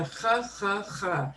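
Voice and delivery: j as a raspy "kh" from the throat; Spanish jota sound. Shown as a demonstration. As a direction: repeat j as a scraped Spanish jota. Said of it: "This sounds like the jota in Spanish".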